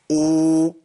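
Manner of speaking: long drawn-out vowel sound; held vowel. Shown as a demonstration. Teacher demonstrating vowel length to students. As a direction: stretch the vowel for a count of two. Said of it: "أقول".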